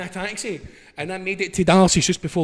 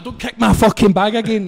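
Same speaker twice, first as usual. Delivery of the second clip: high, loud re-enacted warning; raised, pitched-up telling-off; retold angry shout; 6 semitones higher, 7 dB louder than usual. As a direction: yell a furious warning as if mid-row. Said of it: "I'm shouting to".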